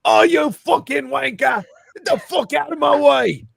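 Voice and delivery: high, loud mock-Cockney shout; hammed-up London street abuse, shouted; London accent. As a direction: yell like an irate caricature Londoner. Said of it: "everybody in London's like this".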